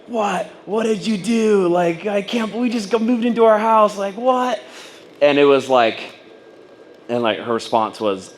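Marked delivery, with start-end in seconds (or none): 0.1-4.6 high, alarmed "What? What did you do?"; panicked disbelief. Retold or imitated. Imagined wife, hearing he lost his job, in high alarmed disbelief. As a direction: voice jumps up in shocked panic. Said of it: "And I was expecting, like, a".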